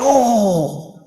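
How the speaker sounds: drawn-out mock "oh" of pain; startled waking groan. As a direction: groan as if just kicked awake. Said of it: "And I wake up like".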